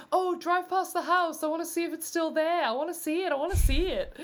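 put-on excited, high-pitched Australian accent; Aussie accent. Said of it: "slip into the, uh, Aussie accent".

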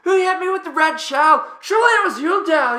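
high, loud Aussie shriek; screaming Marcus. Retold or imitated. Player retelling his teammate's outburst over Mario Kart, screaming. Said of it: "Marcus screaming".

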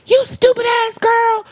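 high, slowed scolding grandma voice; exasperated grandmother. Retold or imitated. Grandmother scolding granddaughter over a celebrity, exasperated and high. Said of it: "She was like".

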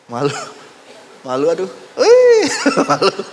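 giggly, squealing embarrassment; bashful disciple. Acted. Preacher playing the embarrassed disciple, squealing and giggling. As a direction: act shy and flustered, squeal.

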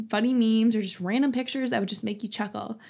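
normal talking voice

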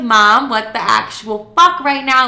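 loud, slow, appalled emphasis; retold exasperation. Daughter confronting mom after breaking news, loudly exasperated. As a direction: lean hard on each word in disbelief.

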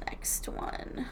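whispered aside. Real, not acted. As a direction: breathe it under the breath. Aside moving to next letter.

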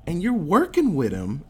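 a hammed-up angry cry; mad outburst. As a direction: spit it at the traitor, mock furious. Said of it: "mad at Tom".

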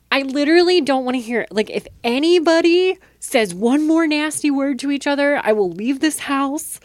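put-on loud, high furious threat to the kids; angry ultimatum. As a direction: lay down the law, voice up and loud. Mother threatens fighting kids.

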